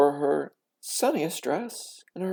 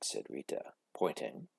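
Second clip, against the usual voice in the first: ordinary delivery, nothing marked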